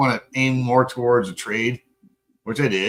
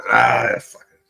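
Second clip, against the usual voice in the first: normal talking voice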